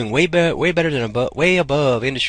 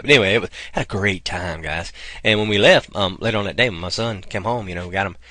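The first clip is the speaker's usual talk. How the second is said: ordinary talk, nothing marked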